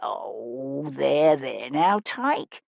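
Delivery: low, soft, slow comforting; gentle old woman's there-there; soothing. Acted. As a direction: hush a crying child tenderly. Woman comforts crying child.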